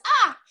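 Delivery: sudden high, loud emotional cry; a choked-up yelp as tears come; overwhelmed little cry breaking through; teary outburst. Real, not acted. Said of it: "I'm gonna get teary-eyed on you guys".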